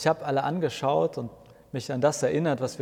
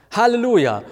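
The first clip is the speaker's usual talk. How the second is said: much higher, loud cry of relief; joyful exclamation; 9 semitones higher, 8 dB louder than usual. A narrator's reading. Martin cheers that the service is late, crying out. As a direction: burst out with a happy cheer. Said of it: "ruft Martin aus".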